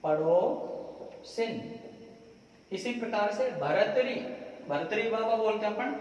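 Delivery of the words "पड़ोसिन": पड़ोसिन very slow, "si" clearly with इ; slow correct form. Shown as a demonstration. Teacher to students, slowly modelling the right word. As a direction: drag out पड़ोसिन syllable by syllable. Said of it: "ये है पड़ोसिन".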